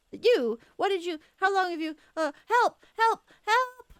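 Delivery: high, loud, frantic calling; panicked cries for help. Acted. Laurentius caught out, yelling high and loud for his friends. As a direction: shriek for help like a cornered man.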